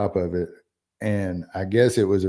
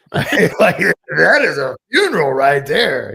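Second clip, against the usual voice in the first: loud, high-pitched awed exclamation; hammed-up, raised-voice admiration; mock amazement; 7 semitones higher, 8 dB louder than usual. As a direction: exclaim it, hugely impressed, playing it up. Acts out his awed reaction.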